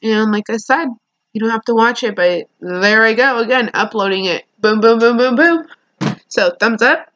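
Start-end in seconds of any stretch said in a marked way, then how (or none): 4.6-5.7 louder, bouncy boom boom, rising on the last; upbeat vlogger sign-off boom chant; playful boom chant. Real, not acted. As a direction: chant it like a beat drop. Playful chant while uploading.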